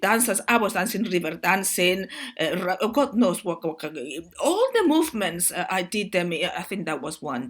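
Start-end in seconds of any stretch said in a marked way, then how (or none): none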